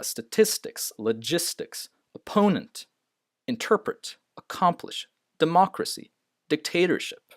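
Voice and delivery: high peak on the second syllable of statistics, logistics, opponent, interpret, accomplish, democracy, dictatorship; sta-TIS-tics, de-MOC-racy, dic-TA-torship; stress on second syllable. Shown as a demonstration. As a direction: punch each word's second syllable. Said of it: "the second syllable is stressed".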